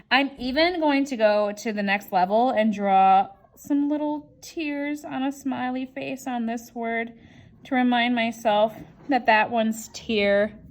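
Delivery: ordinary talk, nothing marked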